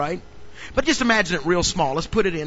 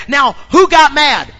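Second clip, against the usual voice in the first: much louder and higher shouted preaching; preaching at full force; 8 semitones higher, 11 dB louder than usual. Acted. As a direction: shout the story to the church.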